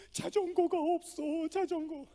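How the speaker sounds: put-on high, loud, slow tearful child voice; crying little girl. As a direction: whine it like a sobbing daughter. Crying daughter speaks to father.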